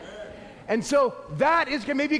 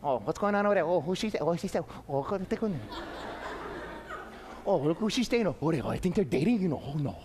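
quiet, lower gossiping murmur; nosy gossip; 7 semitones lower, 6 dB quieter, faster than usual. Retold or imitated. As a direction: gossip quietly like a busybody neighbour.

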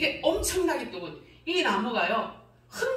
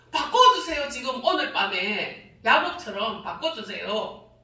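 loud plea; 4 dB louder, slower than usual. Retold or imitated. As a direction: cry 바꿔주세요 out as a loud demand, dropping at the end. Retold prayer, loud take. (바꿔주세요를 높고 크게 외치며 끝을 내림)

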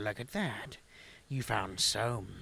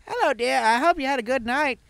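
put-on old granny voice; 10 dB louder, faster than usual. High granny voice again.

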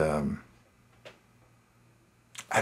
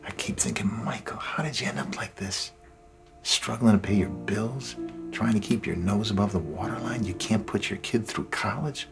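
soft, half-whispered, bitter at himself; quiet self-reproach; 7 dB quieter than usual. Acted. Man mad at himself over unpaid bills, hushed self-reproach. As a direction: turn the anger inward, barely voiced. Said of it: "I'm just so mad at myself".

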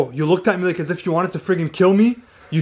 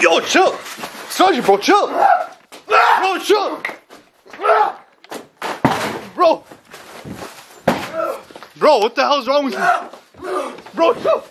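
panicked shouting; 10 semitones higher, slower than usual. Real, not acted. Friend yells at possessed Sargi to calm down, panicked shouting. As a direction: yell at him to back off, scared. Friend attacking him, calling out.

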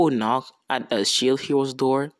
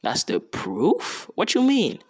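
slow question, then a high jump; confused king; 7 semitones higher than usual. Retold or imitated. King questioning the hero's proof, confused. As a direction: imitate a baffled king querying it. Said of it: "the king's kinda confused".

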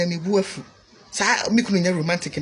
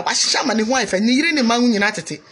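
ordinary talk

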